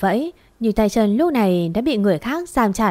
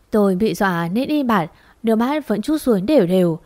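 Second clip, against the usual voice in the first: usual speaking voice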